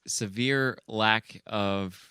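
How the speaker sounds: ordinary talk, nothing marked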